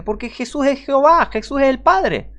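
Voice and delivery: exclaimed punchline. Real, not acted. Preacher driving home his doctrine, high excited exclamation. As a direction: hit the conclusion like a triumphant cry.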